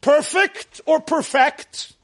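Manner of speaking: "Perfect" first as PER-fect, then per-FECT; contrasted word stress. Shown as a demonstration. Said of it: "How do you pronounce?".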